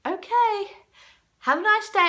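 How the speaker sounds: high, mock-polite brush-off; sarcastic. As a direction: dismiss him with fake cheer. Dismissing the heckler sarcastically.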